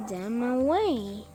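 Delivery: plain reading voice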